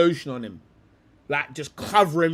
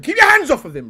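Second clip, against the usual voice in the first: emphatic warning; 9 semitones higher, 8 dB louder, faster than usual. Real, not acted. Repeated warning, raised.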